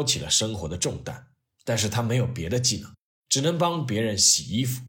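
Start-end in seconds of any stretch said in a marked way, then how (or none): none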